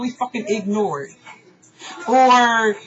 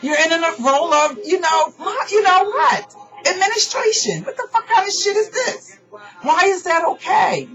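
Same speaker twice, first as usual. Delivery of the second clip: real upset shout cracking into a sob; furious outcry; 9 semitones higher than usual. Real, not acted. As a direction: yell it out, hurt and outraged, nearly crying. Ranting at the moderator, voice cracking.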